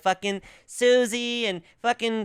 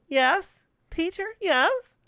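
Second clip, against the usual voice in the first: high, slow eager-pupil voice; prim schoolboy voice; 12 semitones higher, slower than usual. Retold or imitated. Imitating the boy answering his teacher, high and eager. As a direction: squeak it out like a keen little suck-up. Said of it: "he's like this".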